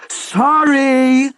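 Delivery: high, loud outraged cry; furious shout. Acted. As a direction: hurl the word back at him. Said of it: "the Queen shouted".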